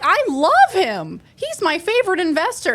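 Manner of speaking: high, loud, over-keen fake fans; mock gushing spam bots. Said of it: "they were all like".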